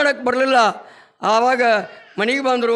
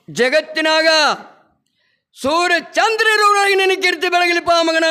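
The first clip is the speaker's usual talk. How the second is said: put-on raised, ringing voice of an old guru blessing; solemn blessing; 5 semitones higher than usual. Guru blessing Sharif with his hand on his head, raised and solemn. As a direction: bestow the blessing high and full.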